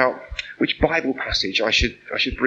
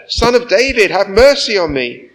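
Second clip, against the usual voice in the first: much higher and louder, pleading with everything; louder shout; 8 semitones higher, 9 dB louder than usual. A narrator's reading. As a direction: cry out over the crowd that rebukes him. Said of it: "he shouted all the more".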